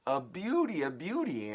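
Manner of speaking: high, loud delighted cry; cried out. Acted. Aunt crying out over the big peach. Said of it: "Aunt Sponge cried out".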